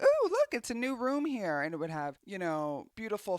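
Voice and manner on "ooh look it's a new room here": higher, louder show-off exclamation; lightly hammed-up excitement; mock delight. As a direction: coo proudly over a new room, playfully. Acted nineties homeowner showing off divider.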